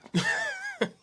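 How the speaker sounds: short, high-pitched laughing squeal. Real, not acted. Laugh at a pun.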